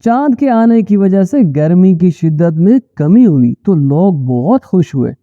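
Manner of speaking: ordinary delivery, nothing marked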